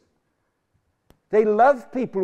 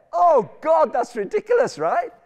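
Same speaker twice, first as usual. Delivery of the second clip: ordinary delivery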